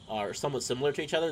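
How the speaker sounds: usual speaking voice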